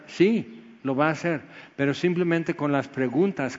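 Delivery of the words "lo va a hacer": ordinary talk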